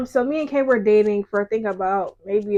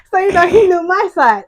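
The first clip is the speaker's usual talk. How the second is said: laughing; 9 semitones higher, 11 dB louder than usual. Real, not acted. Laughing after friend's hums.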